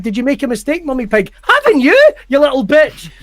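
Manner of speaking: hammed-up high sneer; mocking Peppa Pig. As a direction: mimic a goading cartoon piglet, rising to a squeal. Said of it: "she's always calling you when you fail".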